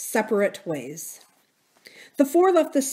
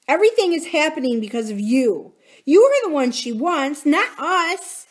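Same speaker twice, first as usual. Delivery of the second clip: higher, rising accusation; angry blame; 8 semitones higher, 5 dB louder than usual. Acted. Drew blames Corinne for everything, angry and upset. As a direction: throw the blame at your friend.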